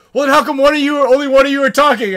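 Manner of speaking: high, loud mock skeptical question; imitated yell across the woods; retold challenging shout. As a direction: holler it back, incredulous. Enemies shout back a challenge.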